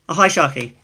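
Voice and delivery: ordinary delivery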